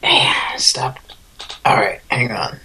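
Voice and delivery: holding back hiccups, hushed; fighting off hiccups mid-sentence; suppressing hiccups. Real, not acted. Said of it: "trying not to hiccup edition".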